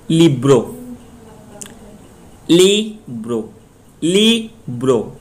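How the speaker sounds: Libre with a long, strong ली and a falling bro; stress on first syllable. Shown as a demonstration. Teacher demonstrates Spanish syllable stress, stressing ली in Libre. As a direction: hit the ली of Libre, let bro drop. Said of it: "ली पे stress दे".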